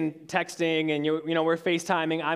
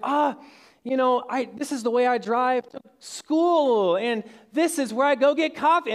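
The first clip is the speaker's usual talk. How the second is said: put-on nervous girlfriend chatter; 6 semitones higher than usual. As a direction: imitate her chattering on to fill the silence. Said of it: "she's just talking 'cause I think she's nervous too".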